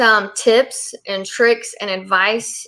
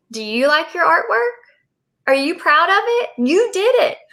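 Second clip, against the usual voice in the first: put-on higher, lilting questions to a proud child; bright praise to child; 7 semitones higher than usual. Parent asks her child about artwork, bright and sing-song. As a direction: coo it to your little one.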